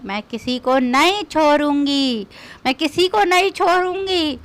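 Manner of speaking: ordinary talk, nothing marked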